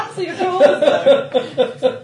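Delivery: loud, high and slow through laughter; laughing out the words; laughing tease. Real, not acted. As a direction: crack up while teasing. Teasing friend, laughing loudly.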